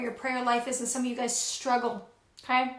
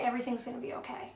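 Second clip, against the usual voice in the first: much softer, quick and gentle; soft reassurance; 10 dB quieter, faster than usual. Pretend. Sitting with a troubled friend, softly reassuring. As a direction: hush them like a worried friend. Comforting someone, sitting with them.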